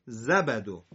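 زبدو run together at normal pace; quick joined reading. Shown as a demonstration. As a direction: say زبدو smoothly in one go. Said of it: "سر هم".

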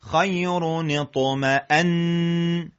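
slow, with a long humming N closing اطمأن; held final nun. Shown as a demonstration. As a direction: hold the doubled N at the end of اطمأن. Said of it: "نون رو یه خود نگه داریم صداشو".

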